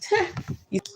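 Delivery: short high giggle; real amused laugh. Real, not acted. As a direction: breaks into a quick laugh. Reader laughs at own joke.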